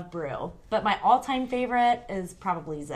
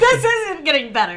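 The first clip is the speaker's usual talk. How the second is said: laughing; 8 semitones higher, 9 dB louder than usual. Real, not acted. Laughing at co-host.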